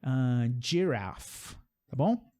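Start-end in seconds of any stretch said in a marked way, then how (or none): 0.6-1.5 giraffe stretched out slowly, dj at the start; slow demo. Shown as a demonstration. Teacher slowly modelling giraffe for students.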